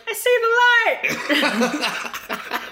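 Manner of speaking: high, excited little call; a lost head calling out, pleased; head's voice. Retold or imitated. Head calls to its body, mock excited. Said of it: "his head will be like".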